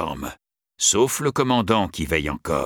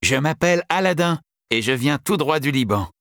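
put-on lightly raised young boy voice; 9 semitones higher than usual. A narrator's reading. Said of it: "le garçon répondit".

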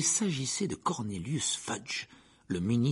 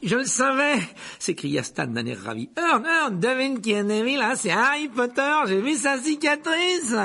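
high, loud, thrilled shout; excited shout; 8 dB louder than usual. Acted. Stan discovers the famous boy, thrilled shout. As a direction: crow with delight at the discovery.

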